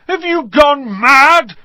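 high, loud, drawn-out yell; bellowing. A narrator's reading. Ron yells at Hermione as the plant strangles him, bellowing. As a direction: bellow in exasperated disbelief. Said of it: "Ron bellowed".

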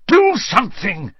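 high-pitched urgent plea; desperate. A narrator's reading. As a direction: beg urgently for help. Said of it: "he said desperately".

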